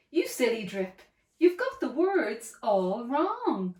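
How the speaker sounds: ordinary delivery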